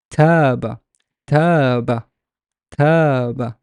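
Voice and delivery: Tab with a long, drawn-out a; teacher modelling a lengthened Tab; long vowel. Shown as a demonstration. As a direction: stretch the vowel of Tab, falling at the end. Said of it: "Why the long vowel?".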